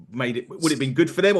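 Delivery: usual speaking voice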